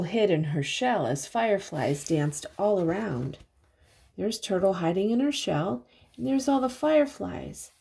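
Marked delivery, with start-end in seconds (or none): none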